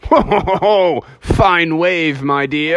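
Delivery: hearty slow ho-ho and praise; jolly father bear. Acted. Father bear praising mother bear's wave, jolly slow voice. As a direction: chuckle it out, drawn out.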